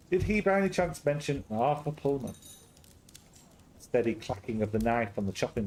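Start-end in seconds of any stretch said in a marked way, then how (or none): none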